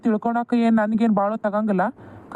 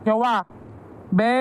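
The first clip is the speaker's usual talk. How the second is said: calling out. Acted. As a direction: summon your mother crossly. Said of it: "ಇವ್ನ್ ಯಾಕೆ ಈ ನಮೂನಿ ಹೊದ್ರಾಕತ್ತಾನ?". (ಅಮ್ಮನನ್ನು ಕೂಗಿ ಕರೆಯುತ್ತಾ)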